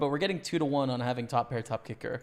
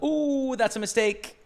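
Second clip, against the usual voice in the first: sharp rising ooh, then dismay; real surprise at a blunder; surprised; 9 semitones higher, 5 dB louder, slower than usual. Real, not acted. Spots a misplay.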